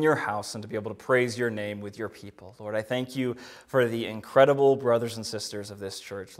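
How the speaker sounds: quiet, earnest prayer voice. Acted. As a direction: pray it softly and sincerely. Opening prayer.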